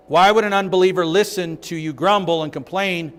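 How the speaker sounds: usual speaking voice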